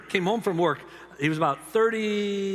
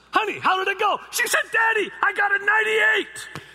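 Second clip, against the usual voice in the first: excited news; 6 dB louder than usual. Retold or imitated. Daughter calling dad after exam, high and loud. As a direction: burst out with the good news.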